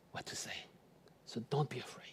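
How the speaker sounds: voiceless whisper into the ear. Acted. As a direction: lean in and whisper the promise. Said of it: "I'll whisper into your ear".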